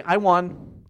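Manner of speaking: mock-triumphant announcement. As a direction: declare the win as a retold boast. Retells walking away declaring victory.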